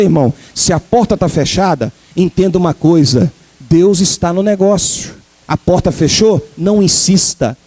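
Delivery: low, steady reassuring sermon; calm encouragement. Acted. Calm exhortation, lower voice.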